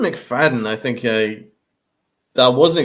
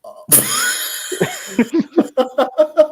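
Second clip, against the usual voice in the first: high laugh. Real, not acted. Reacting to co-host's crude joke.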